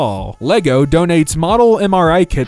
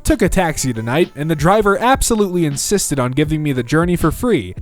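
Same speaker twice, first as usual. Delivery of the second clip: ordinary talk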